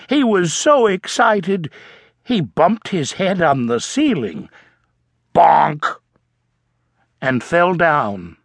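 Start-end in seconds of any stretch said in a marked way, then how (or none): 5.3-6.0 a punchy louder bonk. A narrator's reading. Narrator voicing the bump on the ceiling, punchy bonk. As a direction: hit the bonk like a bump on the head. Sound effect of head bump.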